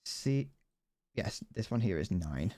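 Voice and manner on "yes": normal talking voice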